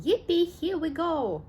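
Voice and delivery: excited shout. Acted. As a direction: cheer on the rushing lift. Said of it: "shouted Grandpa Joe again".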